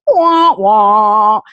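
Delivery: mock sad trombone wah wah. Mimicking the Debbie Downer sketch sound, mock sad trombone. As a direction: sing the sad wah wah of a letdown. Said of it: "and then it's like".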